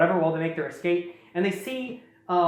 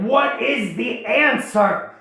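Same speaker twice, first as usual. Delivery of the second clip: slow, raised booming beast question; dragon voice; 5 semitones higher, 4 dB louder, much slower than usual. Retold or imitated. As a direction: demand it slowly as a mock monster. Acts out the dragon's catchphrase.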